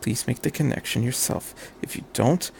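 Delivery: soft whispered plea; inner thought. A narrator's reading. Shinji silently begging Misato, whispered. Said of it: "he thought".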